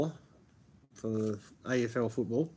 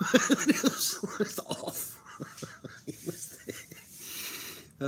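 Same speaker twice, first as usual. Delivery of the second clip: laughing; 9 semitones higher, much slower than usual. Real, not acted. Laughing about Robbo's reply.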